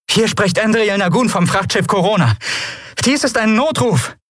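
high, urgent voice into the recorder; distress call. Acted. Andriel sends an emergency call to any ship, urgently.

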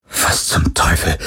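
slow, unvoiced whisper of disbelief; hushed, aghast whisper; breathed shock. A narrator's reading. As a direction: breathe it out, stunned. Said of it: "hauchte Parker".

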